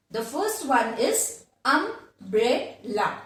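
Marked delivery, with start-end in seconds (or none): 1.6-3.2 "umbrella" slowly split as um, bre, la; syllable by syllable. Shown as a demonstration. Teacher shows students a three syllable word, syllable by syllable. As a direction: break "umbrella" into three spaced beats. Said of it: "words with how many syllables".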